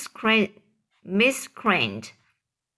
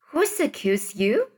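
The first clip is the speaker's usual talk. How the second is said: a mild pitched-up shout; shouted question; 5 semitones higher than usual. A narrator's reading. A voice in the crowd challenging Potter, mild narrated shout. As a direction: fling the question at him, understated. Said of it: "shouted a voice".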